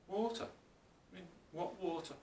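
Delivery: puzzled fish. Acted. Little fish baffled by question.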